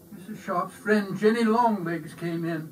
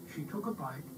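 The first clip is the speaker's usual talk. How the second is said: usual speaking voice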